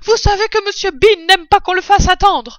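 high, shouted order from upstairs; woman calling out loudly. A narrator's reading. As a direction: holler through the house, high and bossy. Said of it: "qui appelait à grands cris". (femme qui crie, voix aiguë)

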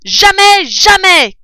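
jamais hurlé très aigu et très fort; cri. Acted. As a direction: crie le refus de toutes ses forces. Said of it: "cria Bogis". (Bogis crie avec rage)

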